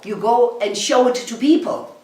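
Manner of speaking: ordinary delivery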